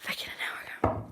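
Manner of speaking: soft whispered repeat. Real, not acted. Vlogger correcting herself in a whisper.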